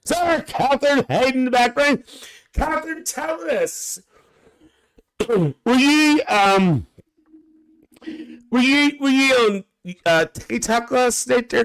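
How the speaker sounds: high, loud sing-song call; teasing call to Catherine; calling out playfully. Real, not acted. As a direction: call her out across the room.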